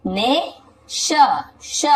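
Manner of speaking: ने, then श, drawn out slowly with a gap; syllable by syllable. Shown as a demonstration. Teacher breaking the word up for learners, ने and श said slowly apart. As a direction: break it into a long "ne" and a separate "sha". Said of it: "इसे पढ़ेंगे".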